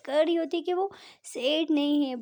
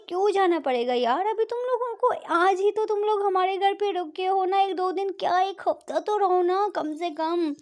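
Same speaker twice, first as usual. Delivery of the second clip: louder, higher angry plea; 5 semitones higher, 4 dB louder than usual. A narrator's reading. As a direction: push back at your sister in anger. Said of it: "परी की mummy गुस्से से बोलती है". (बहन को रोकती हुई, गुस्से में ऊँची आवाज़)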